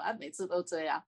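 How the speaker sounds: normal talking voice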